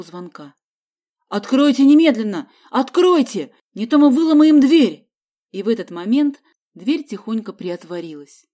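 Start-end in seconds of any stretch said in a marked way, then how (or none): 1.3-5.1 loud, higher shouting at a locked door; demanding shout. A narrator's reading. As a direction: bang the door and yell to open up. Banging on door, demanding entry. (громкий требовательный крик)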